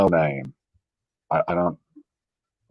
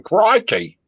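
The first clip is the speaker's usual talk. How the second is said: much higher hammed-up Australian "Crikey"; mock Australian; 13 semitones higher, 4 dB louder than usual. Mocking a viewer's accent guess with a fake Australian accent. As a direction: squeal "Crikey" like a cartoon Aussie. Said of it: "They sound South African".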